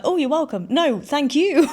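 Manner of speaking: flustered, surprised gratitude; startled reply. Retold or imitated. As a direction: blurt it out, caught off guard and giggling. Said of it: "I'm startled".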